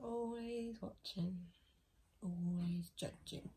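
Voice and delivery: slow eerie whisper; spooky whisper. Pretend. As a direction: creep it out slowly, like a ghost story. Spooky comment on watchful dog.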